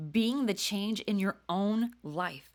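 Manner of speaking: ordinary talk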